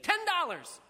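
high, instant counter-bid; called-out bid. A narrator's reading. Shabby man answering his rival, high called-out bid. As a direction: fire back a bid without hesitating.

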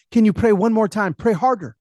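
lightly acted, urgent plea; insistent old lady. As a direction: press the pastor in a mildly pleading voice. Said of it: "She said, 'No, no, no".

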